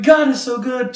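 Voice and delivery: high, loud retold exclamation of praise; half praising. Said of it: "She's half praising the Lord".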